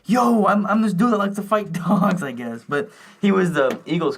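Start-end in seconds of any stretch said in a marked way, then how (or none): 0.0-2.2 high, louder mock boast; hammed-up childish enthusiasm; imitating an excited kid. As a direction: announce it like a hyped-up kid. Acts out his excited childhood self.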